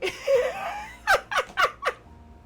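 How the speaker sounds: high, loud, long laugh. Real, not acted. Streamer cracking up at own crying-kid story, long high laugh. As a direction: burst into high-pitched laughter.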